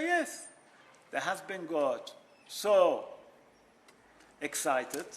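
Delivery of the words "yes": innocent wife. Retold or imitated.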